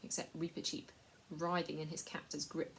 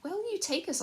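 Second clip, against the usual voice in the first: put-on high girlish voice, words forced out; Lucy struggling; 13 semitones higher, 6 dB louder than usual. A narrator's reading. Frightened Lucy asking the slaver where they go, strained. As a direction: squeeze the words out of a scared girl. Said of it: "getting the words out with some difficulty".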